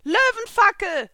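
high, loud, drawn-out battle cry; slow, full-force incantation shout; shouted attack call. A narrator's reading. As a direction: yell the spell's name. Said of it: "schrie ich".

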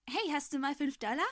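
put-on cheerful, annoying kid. A narrator's reading. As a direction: pipe up cheerfully and a bit annoyingly. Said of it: "fröhliche, weibliche und ziemlich nervige Stimme".

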